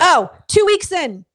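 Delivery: two weeks stretched out slow and loud; emphatic. Real, not acted. Friend revealing how fast he got hooked, slow and loud. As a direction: lean hard on two weeks.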